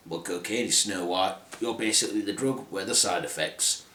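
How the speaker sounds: low, quiet explaining dwarf. Acted. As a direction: drop it deep and soft.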